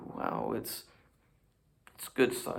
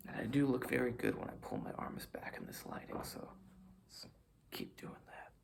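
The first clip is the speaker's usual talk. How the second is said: whispered aside; 7 dB quieter than usual. Real, not acted. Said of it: "aside about flattering lighting".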